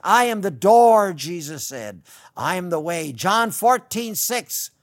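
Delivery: loud, rising pulpit preaching; fiery preaching. Acted. Radio preacher to his listeners, preaching loud and fervent. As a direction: preach it out to the congregation with full force.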